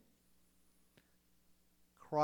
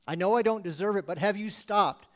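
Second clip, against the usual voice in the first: ordinary delivery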